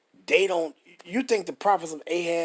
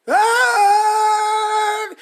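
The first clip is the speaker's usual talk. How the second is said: much higher, much louder held mock holler; mock preacher scream; 12 dB louder than usual. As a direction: belt out a hammed-up preacher scream. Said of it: "Be screaming and hollering".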